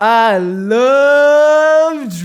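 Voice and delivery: very loud, high, drawn-out holler; drunken ecstatic yell. Retold or imitated. Drunk friend lying on the ground, imitated ecstatic bellowing. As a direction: stretch every word into an imitated drunken bellow. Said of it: "all he says is".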